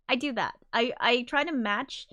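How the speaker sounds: normal talking voice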